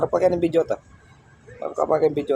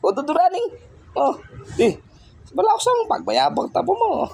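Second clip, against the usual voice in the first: much higher sing-song pet talk; 14 semitones higher than usual. Real, not acted. Vlogger cooing to the pet as it obeys, high baby talk.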